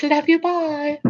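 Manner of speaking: a hint of mom's cheerful farewell; light mom impression; imitating mom. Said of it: "my mom always says".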